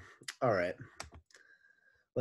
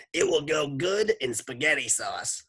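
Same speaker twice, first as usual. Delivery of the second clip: Miss Mush voice; 6 semitones higher, 5 dB louder than usual. A narrator's reading.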